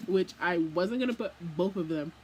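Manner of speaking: ordinary delivery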